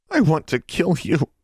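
high, tense words through clenched teeth. Acted. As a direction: force it out through gritted teeth. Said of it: "Mary clenched her teeth".

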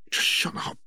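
whispered shut up; hissed. Acted. Sister hisses at brother to stop, whispered. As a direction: hiss it under her breath. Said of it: "Ginny hissed".

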